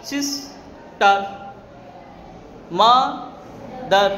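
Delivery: very slow, clear gap between the two beats; syllable by syllable. Shown as a demonstration. Teacher showing how many tries each word takes, syllable by syllable. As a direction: split "sister, mother" into সিস, টার, মা, দার. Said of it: "মা যখন উচ্চারণ করছে একবার".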